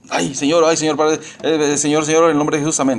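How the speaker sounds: gabbled pleas on one level note; mock hurried prayer. Preacher mimicking prayer that is not praying, mock rushed rattle. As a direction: race through to the amen. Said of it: "Lo que pasa es que estamos".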